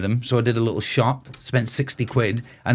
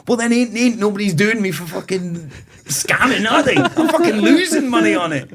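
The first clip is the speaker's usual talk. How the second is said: higher, half-laughing exasperated comeback; laughing retort; 9 semitones higher than usual. Retold or imitated. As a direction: laugh through the protest.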